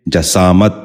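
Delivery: جسامت said "ja-saamat", with a after the j; short a in the first syllable of جسامت; correct pronunciation. Shown as a demonstration. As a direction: say جسامت as "ja-SAA-mat". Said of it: "آپ بھی غور سے سنیے".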